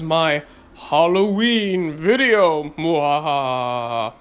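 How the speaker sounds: low slow mad scientist cackle; evil laugh. Pretend. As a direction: mwahaha like a hammy villain. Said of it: "there's my evil laugh".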